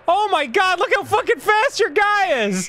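high-pitched disbelief; incredulous outburst. Real, not acted. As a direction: cry out in amazed protest. Opponent's player outruns them.

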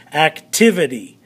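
"Activity" with the second syllable lifted; ac-TIV-ity, accent clearly marked; stress on TIV. Shown as a demonstration. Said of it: "of the syllable is activity".

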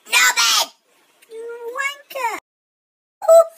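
squeaky high-pitched helium voice. Real, not acted. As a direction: sound like you just sucked a balloon. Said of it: "Tourette's on helium".

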